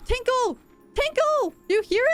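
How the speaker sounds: high, excited calling of the name Tinkle; delighted squeal. Real, not acted. Streamer thrilled at the cat's new name, squealing it high. Delight at chat naming cat.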